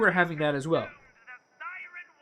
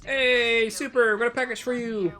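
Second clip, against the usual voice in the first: cheery driver greeting; 8 semitones higher, 3 dB louder than usual. Retold or imitated.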